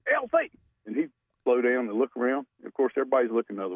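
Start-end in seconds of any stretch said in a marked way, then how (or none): 0.1-0.5 high-pitched calling yell of a name; mock shout across the street; retold yell. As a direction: call his name out sharply from hiding. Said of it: "open up that windshield wiper box and yell".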